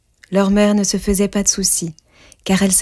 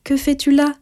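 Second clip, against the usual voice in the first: put-on young girl; 7 semitones higher than usual. A narrator's reading. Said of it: "répliqua Rose Rouge".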